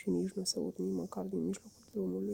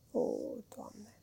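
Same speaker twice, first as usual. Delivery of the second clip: breathless whispered sigh; 3 semitones higher, 6 dB quieter than usual. A narrator's reading. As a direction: whisper it in rapture. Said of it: "ton visător".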